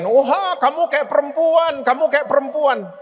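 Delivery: a light sing-song taunt from bullies; a hint of mocking classmates; mock bully taunt.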